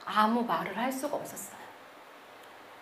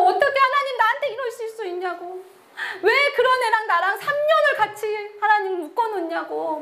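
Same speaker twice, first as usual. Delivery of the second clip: higher, tearful protest to God; crying complaint; 9 semitones higher than usual. Retold or imitated. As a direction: sob out the unfairness. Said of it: "막 울면서". (울면서 하나님께 따지듯)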